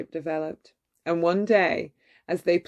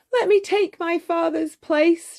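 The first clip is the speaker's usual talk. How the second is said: high, pleading daughter's voice; 11 semitones higher, 4 dB louder than usual. A narrator's reading. Belle begging the beast for her father, high pleading. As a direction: beg to take his place, voice lifted high. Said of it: "she pleaded".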